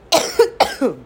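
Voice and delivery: one harsh cough from a sick throat; coughing fit. Real, not acted. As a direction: cough hard, as if unwell. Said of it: "I'm not sorry for the coughing".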